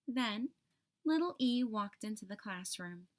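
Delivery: ordinary delivery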